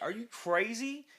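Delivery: high, slow disbelieving cry; horrified. Retold or imitated. Band members horrified at the train dash, high slow cry. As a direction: stretch out the disbelief.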